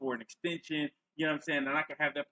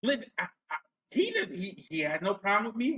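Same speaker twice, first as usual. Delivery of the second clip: higher-pitched mock stammering protest; stuck-in-a-loop mimicry; 3 semitones higher than usual. Host mimics a woman defending him, mock flustered stammer. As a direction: lightly imitate someone flustered and restarting. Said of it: "she gets stuck in a loop".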